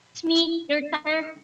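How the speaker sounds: ordinary talk, nothing marked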